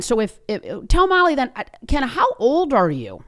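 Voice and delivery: high-pitched mom quizzing dad; imitated mom. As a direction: imitate a wife prompting her husband with a question. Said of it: "and she said".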